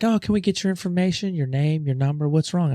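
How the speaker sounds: high, fast front-desk voice; chirpy receptionist. Retold or imitated. Clinic receptionist greeting the injured man, high and quick. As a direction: rattle off the intake questions brightly.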